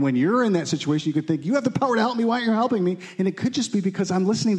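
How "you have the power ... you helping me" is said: high, indignant demand; mock pleading. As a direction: press him with a wounded complaint. Person demanding help.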